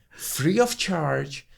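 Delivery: slow, high, savoring free of charge; pleased, drawn-out marvel; delighted. Retold or imitated. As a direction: stretch it out like a treat. Retelling delight at free wine.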